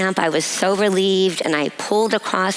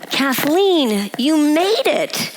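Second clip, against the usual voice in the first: mock yell; 7 semitones higher, slower than usual. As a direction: call out her name happily from far away. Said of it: "he yells out".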